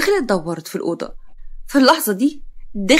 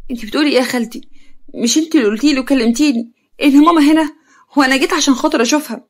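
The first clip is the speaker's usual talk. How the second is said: higher, louder, incredulous protest; shocked; 4 semitones higher, 4 dB louder, faster than usual. Acted. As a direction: protest in shock, building as it goes. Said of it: "يارا خرجت مصدومة".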